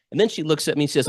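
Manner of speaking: ordinary talk, nothing marked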